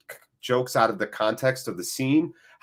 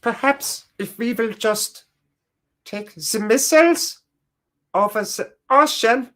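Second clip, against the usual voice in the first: put-on halting comic German accent, pitched way up; German Sellers accent; 10 semitones higher than usual. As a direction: put on a high-pitched German accent, pausing between phrases. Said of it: "my German Sellers accent".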